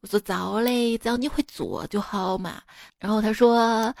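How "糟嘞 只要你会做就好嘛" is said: soft, lower Sichuan dialect; reply casually in Sichuanese. Retold or imitated. Chengdu speaker answering a keen friend, soft Sichuan dialect. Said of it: "说话就是四川话".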